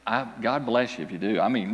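ordinary talk

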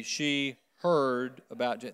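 heard stretched out and weighted, slowly; stress on heard. Shown as a demonstration. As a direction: lean on the word heard so it lands. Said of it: "the emphasis on heard".

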